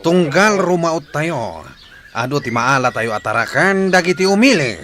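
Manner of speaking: loud, boastful gang leader's speech; loud, swaggering bandit chief. Acted. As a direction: lord it over the gang.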